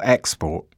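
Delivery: "export" stressed on the first syllable, EX-port. Shown as a demonstration. Teacher reading an example sentence, "export" stressed on its first syllable. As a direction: say "export" as a noun, weight on EX. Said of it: "Stress on the first syllable".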